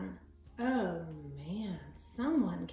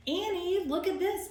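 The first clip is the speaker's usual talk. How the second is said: high, louder call across the hill; 9 semitones higher, 4 dB louder than usual. A narrator's reading. Jack calling to his sister across the hill, raised voice. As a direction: call out to Annie. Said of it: "Jack called".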